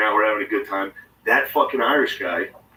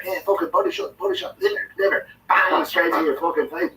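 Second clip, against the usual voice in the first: high, pumped-up trash talk mid shadow boxing; hyped-up Irish fighter; 6 semitones higher than usual. Retold or imitated. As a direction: bark the punches like a hyped boxer. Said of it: "and he's like".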